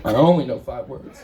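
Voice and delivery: low dopey guy, half laughing; low dim-witted character voice. Acted. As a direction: state it like a proud simpleton. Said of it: "guy with a really, really limited vocabulary".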